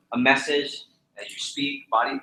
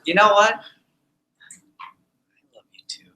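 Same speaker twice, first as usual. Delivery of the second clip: teasing hesitation; 7 semitones higher than usual. Shown as a demonstration.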